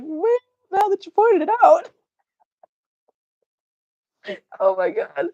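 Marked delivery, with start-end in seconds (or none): 0.0-1.9 high, squeaky mock of a viewer's comment; mock Andrea. Reading a viewer's comment back in a high mock voice. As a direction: parrot the comment in a silly high voice. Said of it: "Andrea's like".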